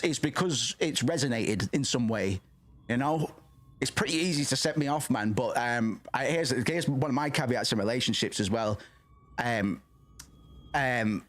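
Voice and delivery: ordinary talk, nothing marked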